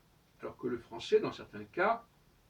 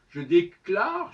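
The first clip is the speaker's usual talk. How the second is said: Je déclare, slow, much louder and higher, proclaimed; mock declaration; 5 semitones higher, 10 dB louder, much slower than usual. Mocking the English claim to own words, pompous decree. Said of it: "je décide que c'est de l'anglais".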